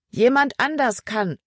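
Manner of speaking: high, called-back retort; urgent call back. A narrator's reading. Emma calls back a warning to Libby, high urgent call. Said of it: "rief Emma zurück".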